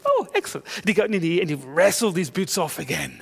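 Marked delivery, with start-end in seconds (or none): 0.1-0.7 bright fake-cheerful chirp, much higher; forced cheerfulness. Teacher hides anger from the boy, forced cheery chirp. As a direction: swallow the anger and chirp it sweetly. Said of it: "You smile, you bite your tongue".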